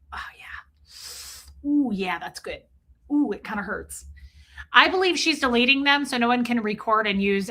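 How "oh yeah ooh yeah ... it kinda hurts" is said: relieved whisper. Real, not acted. Scratching an itch she can't reach, real whispered relief. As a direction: sigh it out as the itch finally gets scratched.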